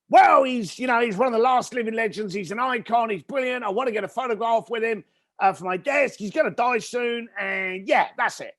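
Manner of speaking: high-pitched fast gush about a legend; excited gushing. Retold or imitated. Caller gushing about [name] to the speaker, excited. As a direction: rattle on, all excitement. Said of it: "He carried on with his excitement".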